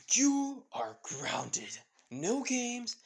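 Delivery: slow, emphatic, rising in pitch; stern punishment. Acted. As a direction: lay down the law like mom. Mother punishes her son.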